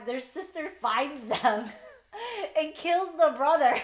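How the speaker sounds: high, laughing through the words; cracking up while telling it. Real, not acted. Reader recounts a shocking scene, laughing hard.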